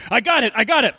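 mildly excited father, voice lifted. A narrator's reading. Pa arriving home with his prize, mildly excited. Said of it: "Pa said excitedly".

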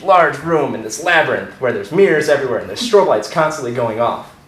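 ordinary talk

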